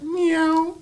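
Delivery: high squeaky meow; a hiding guy's high mock cat meow. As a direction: fake a cat's meow to cover up. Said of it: "It's a cat".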